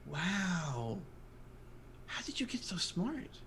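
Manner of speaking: put-on high, slow, soft child voice; awed gnome kid. Gnome kid asks the minotaur, high and soft. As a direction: kid amazed and curious.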